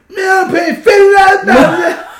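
loud, high-pitched shout; mock outrage. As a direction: shout it, indignant about the money. Banter, mock outrage at cost.

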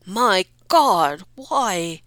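father gets scared; alarmed father. Acted. Farmer fears the Count's visit, panicky.

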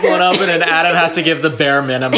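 high, loud laughing delivery; cracking up while talking; speaking through a laugh; laughing through words. Real, not acted. Joins the laughing fit.

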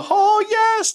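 very high, loud wailing catchphrase; hammed-up falsetto wail, drawn out; Paul Bearer. Wrestling manager in character before dropping it, high hammy wail. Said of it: "he dropped character. He went from the".

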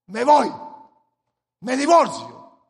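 loud rash declaration; loud outburst. Retold or imitated. Spouse in crisis threatening to leave, loud outburst. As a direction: blurt it out in anger. Rash thoughts in trouble voiced.

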